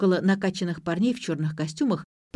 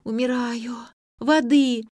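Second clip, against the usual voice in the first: exhausted high whine; whining; 5 semitones higher, 4 dB louder, slower than usual. A narrator's reading. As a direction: moan like she's dying. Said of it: "со стоном ... заныла".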